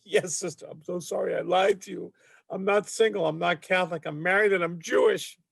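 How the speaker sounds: high-pitched, hammed-up weepy apology; mock tearful confession. Cab driver confesses his lie to the nun, mock tearful. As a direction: confess as if crying your eyes out. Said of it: "starts crying his eyes out".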